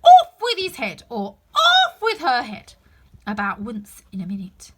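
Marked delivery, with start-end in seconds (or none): 0.0-1.0 loud, high, slow raging order; furious shouting. Acted. The furious Queen shouting beheadings at players. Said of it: "stamping about and shouting". 1.5-2.7 loud, high, slow raging order, a laugh in it; furious shouting. Acted. The furious Queen shouting beheadings, slow and loud. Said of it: "stamping about and shouting".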